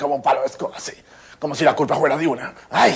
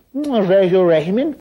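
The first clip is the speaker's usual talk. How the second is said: pompous politician; 7 semitones higher, 6 dB louder, slower than usual. Retold or imitated. Imita a un político con trabalenguas.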